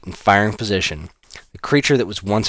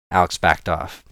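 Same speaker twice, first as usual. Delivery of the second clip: ordinary delivery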